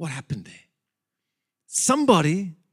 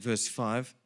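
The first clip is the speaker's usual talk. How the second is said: normal talking voice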